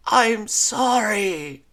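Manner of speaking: slow, loud, high anguished scream. Acted. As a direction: scream the apology back. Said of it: "Lyra screamed back".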